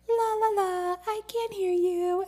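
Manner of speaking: put-on childish fingers-in-ears tune; playful ignoring singsong; mock denial. Ignoring the universe, ears covered.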